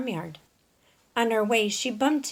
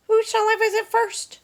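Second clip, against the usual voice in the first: high, bright little hen voice; Dora the hen; 14 semitones higher, 5 dB louder than usual. Acted. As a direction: play a chirpy young hen wondering aloud.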